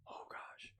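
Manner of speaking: quick whisper to the viewers; whispered aside. Real, not acted. Vlogger asking viewers for comments, whispered. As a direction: drop to a hurried whisper.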